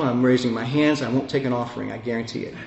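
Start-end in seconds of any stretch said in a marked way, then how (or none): none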